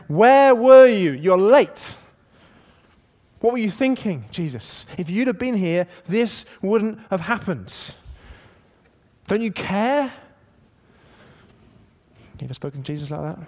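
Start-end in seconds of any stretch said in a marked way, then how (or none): 0.1-10.1 high, loud, accusing and hurt; Mary's anguished reproach. Retold or imitated. Mary confronting Jesus for arriving late, angry and hurt. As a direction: demand an answer through grief. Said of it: "she says, in effect".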